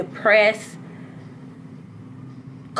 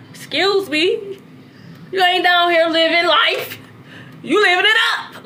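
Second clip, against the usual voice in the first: higher-pitched mock indignation; lightly voiced scolding great-grandmother; great-grandmother's tough love; 5 semitones higher than usual. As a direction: imitate an elder calling someone out. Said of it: "I just hearing her voice".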